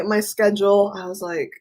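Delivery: ordinary talk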